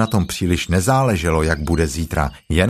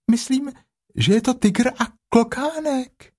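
small high timid piglet voice. A narrator's reading. Piglet asking Pooh about the animal, high and timid. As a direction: squeak it out like a tiny nervous piglet.

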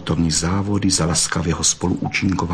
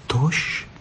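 sighing, tight-throated; 5 semitones higher than usual. A narrator's reading. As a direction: sigh it anxiously as the vixen. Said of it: "vzdechla Bystrouška a hrdlo se jí svíralo". (povzdech se staženým hrdlem)

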